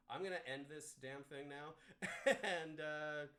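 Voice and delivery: laughing while he speaks. Real, not acted. Laughing while ending stream.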